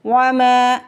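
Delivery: वमा with the मा drawn long; long meem. Shown as a demonstration. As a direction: stretch the मा of वमा. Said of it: "मीम को खींच के अदा करेंगे". (वमा में मीम को खींच कर)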